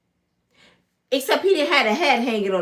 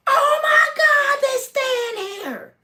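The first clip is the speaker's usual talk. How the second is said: starstruck shriek; much slower than usual. Retold or imitated. A fan seeing [name] pull up at the hotel, shrieking. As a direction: shriek in disbelief, then fade.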